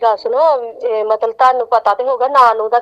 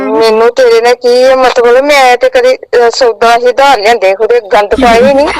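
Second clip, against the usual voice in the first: loud angry denial; 3 semitones lower, 5 dB louder than usual. Real, not acted. Angry woman disputes prank debt call.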